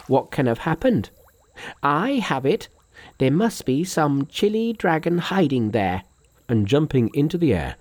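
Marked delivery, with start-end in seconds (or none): 0.1-6.0 higher monkey voice working it out; puzzle, then realise, in a high voice; Monkey's character voice. A narrator's reading. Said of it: "thought Monkey to himself".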